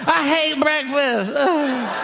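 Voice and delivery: high, drawn-out mock wail; hammed-up crying kid; whimpering kid. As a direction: whine like a frightened child. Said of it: "the kid's like".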